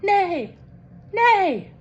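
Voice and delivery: high whinnying nay; Kitty neighing. A narrator's reading. Kitty insists she is a unicorn, high neighing. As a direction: neigh it like a pony. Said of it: "says Kitty".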